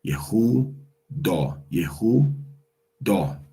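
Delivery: Yehuda said quickly, then drawn out slowly. Shown as a demonstration. Teacher drills the word fast then slow. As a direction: rattle off Yehuda, then stretch it.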